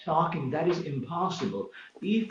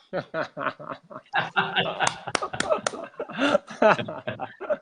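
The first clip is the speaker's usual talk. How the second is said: long real chuckling laugh; hearty laugh. Real, not acted. Laughing with co-host over twenty thousand times of practice, amused laughter. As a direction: burst into real, rolling laughter.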